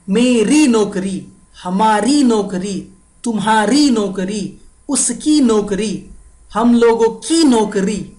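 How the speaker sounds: री and की punched in each phrase, मेरी to हम लोगों की; stressed feminine endings. Shown as a demonstration. Teacher drilling the sequence, every री and की stressed. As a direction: chant the list, leaning on each री and की. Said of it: "see the sequence".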